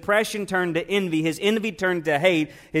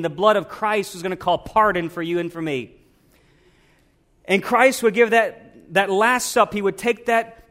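louder, higher preacher's climb, drawing out key words; full-force preaching; 4 semitones higher, 4 dB louder than usual. Acted. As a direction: preach it out to the congregation, building.

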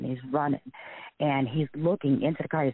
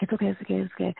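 higher-pitched retold pleading; mock placating; 6 semitones higher, much faster than usual. Victim calming the man choking her, lightly acted placating. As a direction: soothe him hastily in a raised voice.

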